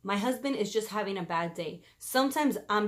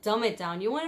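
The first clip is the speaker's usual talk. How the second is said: ordinary talk, nothing marked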